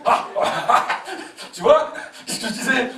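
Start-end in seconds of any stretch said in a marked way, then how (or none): 0.3-1.5 a breathy high-pitched giggle; high laughing squeal. Acted. The child gloating at his father, squealing with laughter. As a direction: squeal with glee at being proved right.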